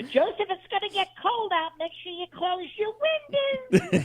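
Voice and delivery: high, slow fretting aunt voice; Aunt Teresa. Retold or imitated. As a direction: fuss like a worried old aunt. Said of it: "that's my Aunt Teresa".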